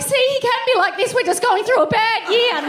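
high, pleading excuse-making voice; mock abused partner excusing. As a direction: defend him like a hopeful victim. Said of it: "you're like".

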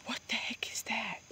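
whispered alarm at a sound. Real, not acted. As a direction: whisper it tensely. Second take, whispered.

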